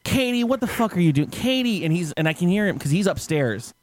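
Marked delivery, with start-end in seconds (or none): 0.0-1.8 high-pitched mock yell without real volume; imitated exasperated calling. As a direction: play a man calling down in exasperation. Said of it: "because he goes".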